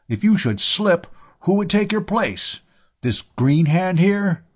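high-pitched incredulous questions; indignant. A narrator's reading. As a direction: protest indignantly as the captain. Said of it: "his captain's indignation".

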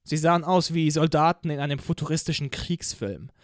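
usual speaking voice

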